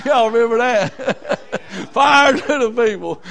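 laughing through the words. Real, not acted. Preacher recalling the girls' chant, laughing.

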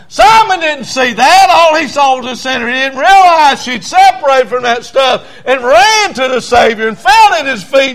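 loud, high revival preacher cry; impassioned shouted testimony; full-force preaching. Acted.

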